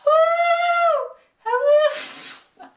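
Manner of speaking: high, drawn-out "woo" howl; amateur coyote howling; imitated coyote howl. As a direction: howl like a distant coyote, badly faked. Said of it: "heard coyotes howling in the distance".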